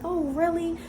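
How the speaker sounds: put-on girlish gasp. Girl falling for the boy.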